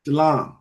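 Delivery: shalom with ah, no O sound; short shalom. Shown as a demonstration. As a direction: repeat shalom as sha-lahm. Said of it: "The shorter version, like shalom".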